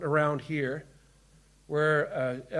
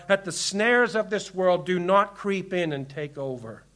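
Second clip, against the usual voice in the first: ordinary delivery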